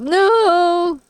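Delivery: high, loud, drawn-out wail; hammed-up cry of despair; mock anguished cry. Player as creeper blows up the sheep, mock anguished cry. Acted reaction to creeper blowing up.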